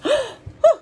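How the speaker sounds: high, loud gasp of fright; storybook gasp of surprise; startled gasp. A narrator's reading. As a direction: gasp as if just scared. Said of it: "Kind of scared them a little bit".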